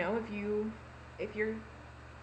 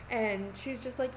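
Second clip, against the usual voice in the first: usual speaking voice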